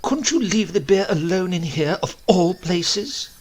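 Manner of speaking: high, loud, outraged; indignant spinster. A narrator's reading. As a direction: scold him from above. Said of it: "Not content with desecrating".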